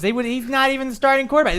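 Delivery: high, whiny outraged protest; hammed-up kid's complaint; exasperated rant. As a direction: rise into indignation, then land hard. Mock rant about roster.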